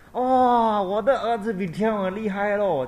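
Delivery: put-on high, loud proud old mother voice; mother boasting about her son. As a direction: beam with pride in your son.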